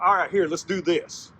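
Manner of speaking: loud, high, slowed-down call to action; rally a partner into a scheme; rallying Wolf. Acted. Wolf rallies Rabbit to a plan.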